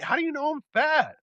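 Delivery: indignant retort. Retold or imitated. Mock arguing with online commenters.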